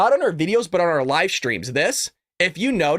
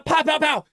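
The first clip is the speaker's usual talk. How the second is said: imitated gunshots; 10 semitones higher than usual. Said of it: "And he goes".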